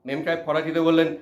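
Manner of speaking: ordinary delivery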